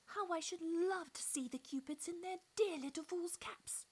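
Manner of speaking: high, delighted exclamation; eager cry. A narrator's reading. Mamie delighted at the idea, high eager cry. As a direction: gush about the Cupids. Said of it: "Mamie cried".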